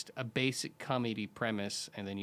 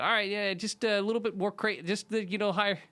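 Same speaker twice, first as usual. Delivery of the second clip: higher, lightly energized coaching; upbeat directing; 10 semitones higher, 4 dB louder than usual. Retold or imitated. Director asking a natural actress to be sillier, upbeat coaxing. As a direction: nudge the actor upward with a raised pitch.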